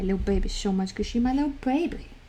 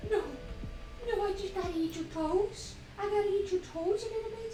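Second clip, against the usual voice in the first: much higher, quick cooing; 10 semitones higher, 8 dB quieter than usual. Real, not acted. Mother telling her dog she must eat its toes, high baby talk. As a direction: squeal it sweetly at the dog. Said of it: "I'm in baby talk mode".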